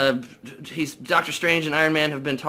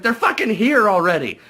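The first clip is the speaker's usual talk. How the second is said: higher, louder mock alarm, hitting fucking; alarmed shout; 7 semitones higher, 6 dB louder than usual. Heroes find the invaders already on them, louder higher mock alarm. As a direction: cry out that the enemy is already here.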